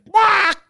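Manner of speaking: very high bird quack; toucan squawk. Retold or imitated. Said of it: "done a Toucan impression".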